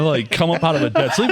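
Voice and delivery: usual speaking voice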